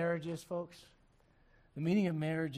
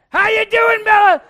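very loud, high bellowed hello; loud greeting; 23 dB louder than usual. Retold or imitated. Ronnie greeting timid Bella with a loud bellow. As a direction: blast a hello at a shy little girl. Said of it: "Bella was super timid".

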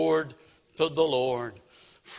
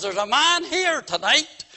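lightly imitated blunt evangelist, pitched higher; a hint of blunt pulpit accusation, raised pitch; W P Nicholas; 7 semitones higher than usual. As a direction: mimic a preacher calling out a sinner. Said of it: "you can just picture him in his accent".

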